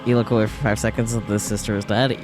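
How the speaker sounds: ordinary talk, nothing marked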